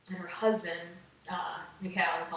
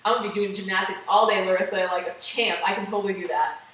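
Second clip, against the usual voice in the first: mock bravado; 8 dB louder than usual. Mock promise to the coach.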